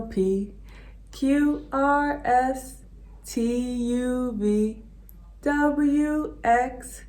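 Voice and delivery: ordinary talk, nothing marked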